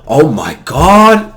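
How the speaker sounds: slow, drawn-out oh my god; mock awe at a combo; amazed. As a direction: stretch it out in wonder. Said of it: "I wanna be like".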